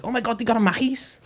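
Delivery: put-on high, gushing childlike excitement; excited kid. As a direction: squeal it like an overwhelmed kid. Acting his childhood excitement.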